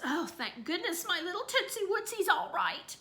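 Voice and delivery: put-on mother cooing in relief over her baby; relieved cooing.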